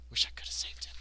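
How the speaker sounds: whispered echo of regret; hushed, voiceless lament. Acted. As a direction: whisper it to yourself mournfully.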